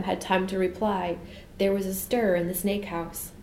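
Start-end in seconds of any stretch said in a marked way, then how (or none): none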